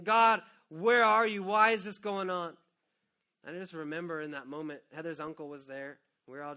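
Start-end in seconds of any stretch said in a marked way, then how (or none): 0.0-2.6 loud, slow, higher pleading; anguished cry to God. Retold or imitated. Grieving father demanding answers from God, loud and slow. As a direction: cry out where are you. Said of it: "I'd done everything".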